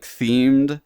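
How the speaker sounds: usual speaking voice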